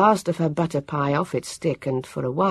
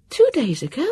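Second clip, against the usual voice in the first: high rising disbelief on two; surprised exclaim; 11 semitones higher than usual. A narrator's reading. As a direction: echo it back in shock. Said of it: "Vivian exclaimed".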